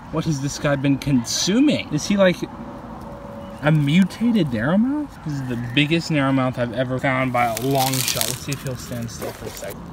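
ordinary talk

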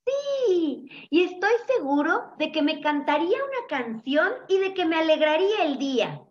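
high, excited little voice; eager high-pitched storybook chick; delighted chick. A narrator's reading. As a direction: squeal the yes, then gush on up high. Delighted chick imagines a mother.

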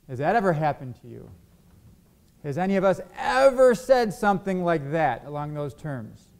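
usual speaking voice

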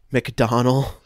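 ordinary talk

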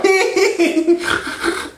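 high nerdy snort-laugh; imitated high-pitched sitcom-nerd giggle; Steve Urkel. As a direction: squeal out a goofy nerd laugh. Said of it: "Urkel kinda like".

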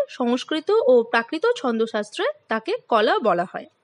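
normal talking voice

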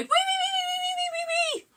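very high, squealing wee wee wee; tickling piggy squeal. Acted. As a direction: squeal like a little piglet all the way home. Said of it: "this little piggy cried".

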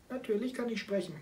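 high, meowing little cat voice; meowing kitten voice. Acted. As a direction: meow the words like a kitten. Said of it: "miaute das Kätzchen". (hohe miauende Kätzchenstimme)

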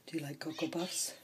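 whispered, breath only, teasing; soft whisper. Acted. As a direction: lean in and whisper the question. Whispered question to the cat.

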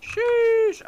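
high, loud drawn-out sheesh; excited sheesh. Real, not acted. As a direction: blurt it out, thrilled. Excited exclamation in game.

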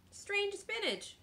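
put-on high, slow storybook child voice; child's matter-of-fact answer. A narrator's reading. Child answers the disgusted pirate.

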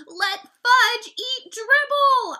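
very high, very loud, drawn-out scream. A narrator's reading. Brother tells Mom what Fudge did, screaming. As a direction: scream each word in outrage. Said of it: "I screamed".

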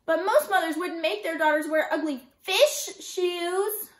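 put-on loud, high indignant child; bellowing. A narrator's reading. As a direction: bellow the grievance, stretching it out. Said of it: "Kirsty bellowed".

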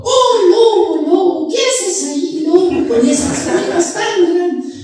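put-on very high sing-song little-girl voice; Caperucita. Asking the wolf about big ears, sing-song falsetto. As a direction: squeal it in drawn-out falsetto.

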